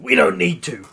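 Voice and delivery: loud, high dismissive scoff. A narrator's reading. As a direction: brush them off loudly. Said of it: "Bakugo scoffs".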